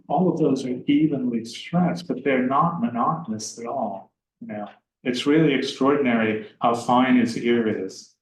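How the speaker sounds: normal talking voice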